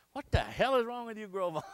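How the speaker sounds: high annoyed scold. Retold or imitated. Father scolding after prayer.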